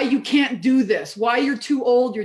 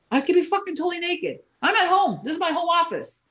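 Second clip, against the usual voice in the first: excited brag; 8 semitones higher than usual. Real, not acted. Excited bragging about home office.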